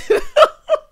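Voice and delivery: high, loud burst of cackling; helpless high laugh. Real, not acted. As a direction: break up laughing. Cracks up at foundation name.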